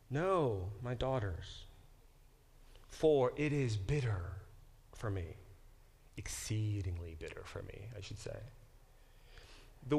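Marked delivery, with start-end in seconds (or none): 0.1-8.4 bitter lament. Acted. Teacher reading Naomi's lament, softening to a whisper. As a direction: let it sink into a whispered sigh.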